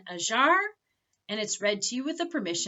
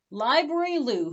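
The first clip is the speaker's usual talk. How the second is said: ordinary delivery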